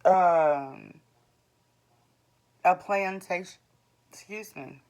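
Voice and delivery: hiccuping mid-sentence; words broken by hiccups. Real, not acted. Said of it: "I got the hiccups all of a sudden".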